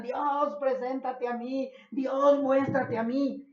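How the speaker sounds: súplica gritada y aguda hacia el cielo; gritando a Dios. Retold or imitated. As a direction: clama a Dios a voz en cuello, desesperado. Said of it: "gritan y gritan". (grito suplicante a Dios)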